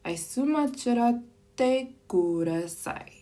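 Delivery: "Ice matcha latte kudasai" said slowly and clearly; slow demo phrase. Shown as a demonstration. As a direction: spell out the order slowly for learners. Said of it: "You could also say".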